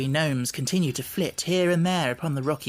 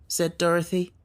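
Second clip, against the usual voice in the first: usual speaking voice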